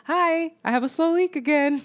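lightly voiced sweet greeting; sweet customer voice. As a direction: play the innocent regular customer.

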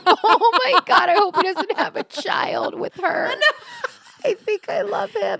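high, loud laughing wail; half laughing, half crying outburst; crying with laughter. Real, not acted. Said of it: "I'm crying".

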